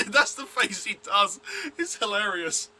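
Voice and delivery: high, loud words squeezed through laughter; can barely get it out for laughing; real giggling speech; laughing while talking. Real, not acted. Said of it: "I can't contain myself".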